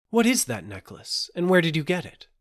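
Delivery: urgent, demanding princess; crying out. A narrator's reading. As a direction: blurt the questions with mild urgency. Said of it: "cried Fioramond".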